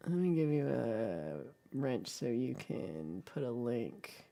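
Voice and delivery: low, slow, absent-minded stretching of words; slow, drawn out. Real, not acted. Streamer giving viewer a wrench to post links, slowly drawn out. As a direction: draw it out while busy with a task. Slow repeat while doing the task.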